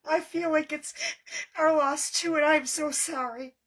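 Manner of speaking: really fighting tears while apologizing; tearful. Real, not acted. Crafter grieving with a bereaved viewer, tearful. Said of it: "guys, I'm sorry".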